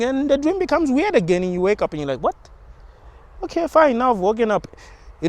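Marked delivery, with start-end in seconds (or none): 2.2-4.7 startled, then resigned. Retold or imitated. Dreamer waking yet again.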